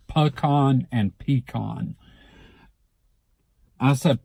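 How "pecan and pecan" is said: first pecan puh-KAHN, second pecan PEE-kahn; two local variants. Shown as a demonstration. Speaker comparing local variants, pecan as puh-KAHN then PEE-kahn. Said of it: "I do hear pecan and pecan".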